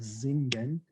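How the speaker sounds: "singen" starting with a buzzed Z sound; voiced Z at the start of "singen". Shown as a demonstration. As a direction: say "singen" as zing-en, German style. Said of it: "I pronounce the S as a Z".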